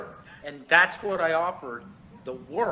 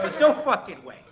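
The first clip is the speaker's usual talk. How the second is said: loud, laughing, can't-believe-it; laughing disbelief; 8 dB louder than usual. Real, not acted. Laughing disbelief at cokeheads in space.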